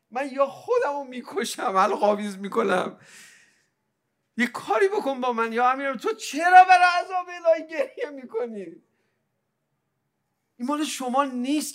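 high tearful cry building to a shout; anguished plea. Acted. Devotee imploring Amir al-Mu'minin over his weeping, anguished plea.

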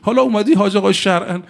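high, urgent, exasperated yelling; pitched far up in a retold panic. The speaker yelling at a pious bystander to lift the child, high and urgent. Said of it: "گفتم".

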